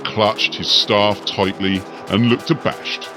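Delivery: usual speaking voice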